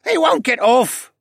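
put-on high, slow kid voice, grumbling; a put-upon boy complaining. A narrator's reading. Stable boy complaining about the bird, high grumbling. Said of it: "Ryland grumbled".

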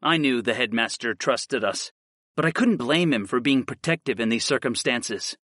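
ordinary delivery

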